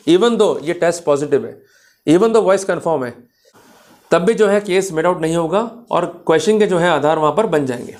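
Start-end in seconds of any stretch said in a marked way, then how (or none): none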